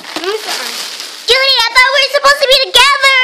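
high, fast, hammed-up wail; mock heartbroken plea. Friend pleads with Judy in a high, fast mock wail. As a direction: squeal it like a jilted lover, fast.

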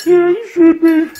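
guilt-trip in a high pup voice; high sulky dog voice. Pretend. Voicing the dog.

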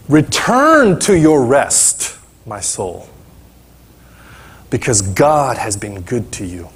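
slow, quieting scripture reading; solemn psalm read; psalm recitation. Acted. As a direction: read the verse like a soothing promise.

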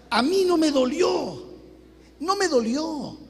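ordinary talk, nothing marked

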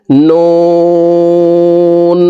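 "নুন" with round lips and a very long oo on a high level tone; four-alif stretch. Shown as a demonstration. Teacher demonstrating noon, long round-lipped hold. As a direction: draw out "নুন" as one steady chanted note. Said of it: "ঠোঁট গোল হবে".

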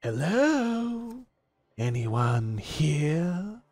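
calling out. Acted. Game master as unseen stranger seeking anyone, calling out. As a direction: holler slowly into the distance, stretching each word. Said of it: "You hear someone call out".

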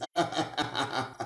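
long, low, soft chuckle; mocking chuckle. Real, not acted. Commentator laughing at the politician's threat, low chuckle. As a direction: chuckle low in separate little bursts. Amused at the leader's clip.

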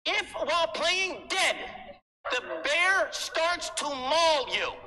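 mock warning shout. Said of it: "in big letters on the paper".

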